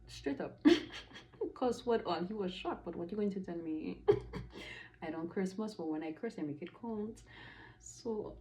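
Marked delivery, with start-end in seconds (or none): none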